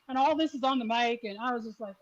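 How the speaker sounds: ordinary delivery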